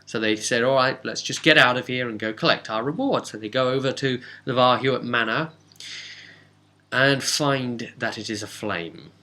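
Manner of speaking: ordinary delivery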